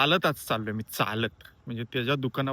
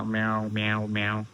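mock meowing; 2 semitones lower than usual. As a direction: meow like the cat crying all morning. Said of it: "ओरडत होता". (मांजरीसारखं हलकं म्याव)

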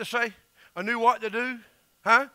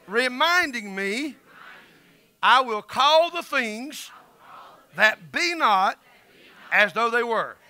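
slow, louder, word-by-word proclamation; preaching declaration; 4 dB louder, slower than usual. Acted. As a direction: declare each phrase like a vow, slowly.

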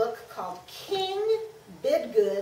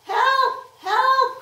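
high, loud cry for help; page crying for help; 12 semitones higher, 12 dB louder than usual. Acted. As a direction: shout for help in panic. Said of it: "cried the page".

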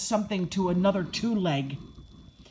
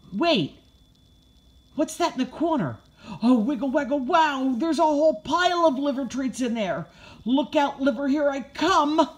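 higher-pitched, eager puppy excitement; excited bark; 7 semitones higher, 3 dB louder than usual. A narrator's reading. Puppy spotting a pile of liver treats, excited. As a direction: build from discovery to a gleeful charge. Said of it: "I bark as my paws start".

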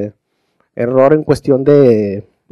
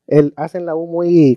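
usual speaking voice

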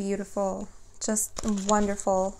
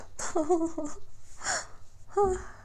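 a sudden high-pitched laugh; real laugh at a surprise card; 9 semitones higher, 5 dB quieter than usual. Real, not acted. Shocked by the card reading.

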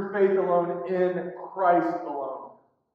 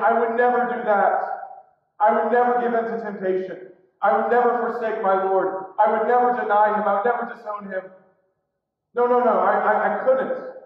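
loud, vehement denial; overconfident, pressing assertion; insistent protest; 2 semitones higher, 5 dB louder than usual. Retold or imitated. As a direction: pile up the denials forcefully. Acting out our self-confident protests.

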